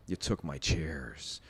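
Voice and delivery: very low, slow and soft confrontation; quiet menace. Retold or imitated. Confronting the man who took his chairs, quietly menacing. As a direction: say it slowly under your breath, threatening.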